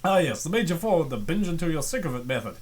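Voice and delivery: ordinary talk, nothing marked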